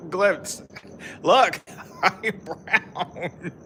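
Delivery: slow words broken up by laughter; laughing hard. Real, not acted. Showing viewers how brown, breaking into laughter. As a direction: crack up halfway through saying it. Joking about own tan, cracking up.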